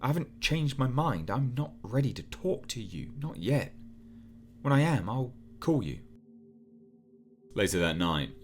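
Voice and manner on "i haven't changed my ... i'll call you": lightly raised, higher woman's voice; a hint of a hurt woman on the phone; Sarah's voice.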